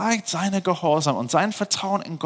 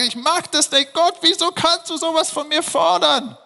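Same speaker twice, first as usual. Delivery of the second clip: imagined protest. Pretend. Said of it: "vielleicht hat er geweint oder gestampft".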